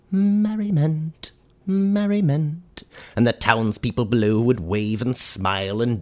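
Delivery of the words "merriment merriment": high, slow, drawn-out mock scream of the word; sing-song it off a hilltop, hammed-up; hammed-up hilltop cry, high and stretched. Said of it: "stand at the top of a beautiful hillside and scream".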